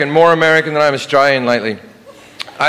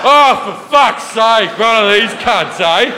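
slow, higher ocker Aussie grumble; broad Australian accent; 6 semitones higher, slower than usual. Retold or imitated. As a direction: drawl it like an annoyed Aussie. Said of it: "They're like".

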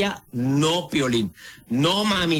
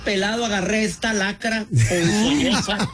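mock lament; 5 semitones higher than usual. Acted lament about choosing this partner.

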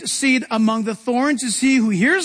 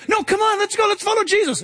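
high, excited coaxing; eager urging; 11 semitones higher than usual. Retold or imitated. Peter urging the scared disciples to follow Jesus, high and excited. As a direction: pull the others along eagerly.

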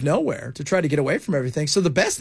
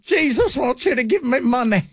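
mock televangelist; 7 semitones higher than usual. Mocking a TV preacher.

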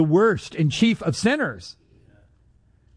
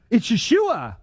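growing realisation; 6 semitones higher than usual. Retold or imitated. Repeated realisation, growing each time.

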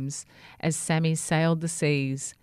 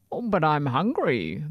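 rough whispery bird voice; squawking albatross; 2 semitones lower than usual. A narrator's reading. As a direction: squawk it like a hungry seabird. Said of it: "the albatross squawked".

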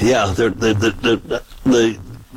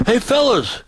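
high call down the sidewalk; retold call to the guys down the sidewalk; 10 semitones higher than usual. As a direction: holler to the fellas down the street. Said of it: "So I yelled down the sidewalk".

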